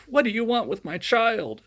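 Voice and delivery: put-on a mother fearing for her baby; alarmed mother. Mother confronting the villain.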